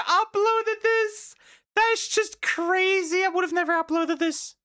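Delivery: squeaky high-pitched laughing mockery. Mocking the dad who posted his reaction, high squeaky voice. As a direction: squeak it out in mock disbelief.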